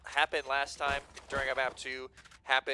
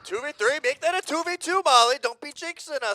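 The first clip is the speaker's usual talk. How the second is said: high, loud yelling reaction; excited; 10 dB louder than usual. Real, not acted. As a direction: shout it up as the round swings. Caster reacts to swinging clutch.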